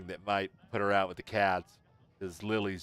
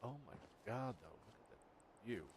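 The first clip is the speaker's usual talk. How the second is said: whispered awe; 19 dB quieter than usual. Real, not acted. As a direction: breathe it out in wonder. Awed at the view.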